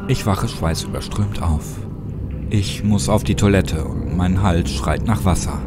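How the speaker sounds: usual speaking voice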